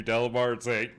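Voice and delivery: usual speaking voice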